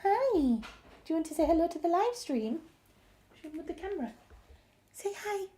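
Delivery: high, sing-song dog greeting; cooing pet talk. Real, not acted. Asking the dog to say hi to the live stream, cooing. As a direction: coo to a beloved pet.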